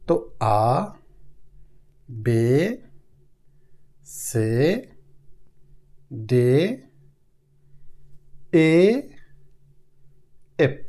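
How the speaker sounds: আ, বে, সে, দে said low and soft, each rising; German letters. Shown as a demonstration. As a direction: recite আ বে সে দে slowly.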